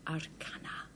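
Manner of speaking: whispered under the breath; hushed aside at a posh party; mumbled whisper. Shown as a demonstration. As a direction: murmur it discreetly to the host. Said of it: "might sort of mumble the word".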